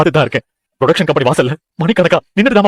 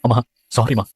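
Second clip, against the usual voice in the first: sorry; 5 semitones lower, 5 dB quieter than usual. Acted. Son apologizing to mother.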